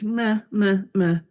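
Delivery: flat, listless mock meh; a shrugging, unimpressed meh; bored meh. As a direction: imitate the bored client half-heartedly. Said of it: "everything was kinda meh".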